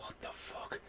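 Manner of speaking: breathy stunned whisper; whispered disbelief. Retold or imitated. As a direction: whisper it under your breath, baffled. Stunned reaction to weird film.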